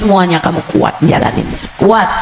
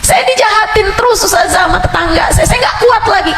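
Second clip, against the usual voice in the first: much higher, shouted plea; desperate congregant; 12 semitones higher than usual. Retold or imitated. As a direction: cry out at your limit. Congregant complaining. (jamaah mengeluh ke ustazah, berteriak putus asa)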